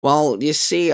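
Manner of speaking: ordinary delivery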